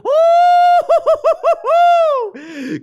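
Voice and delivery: very high, loud delighted squeal of laughter; host shrieking with glee at a bid. Real, not acted. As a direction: let out a joyful shriek. New bid interrupts.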